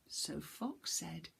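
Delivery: ordinary delivery, nothing marked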